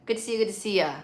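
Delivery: usual speaking voice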